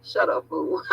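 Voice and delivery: ordinary talk, nothing marked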